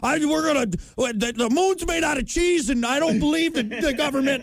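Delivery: high, wild-eyed conspiracy theorist voice; conspiracy nut voice. Retold or imitated. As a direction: play a frantic tinfoil-hat caller. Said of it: "guys wearing tinfoil hats going like".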